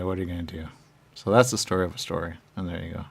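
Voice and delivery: ordinary delivery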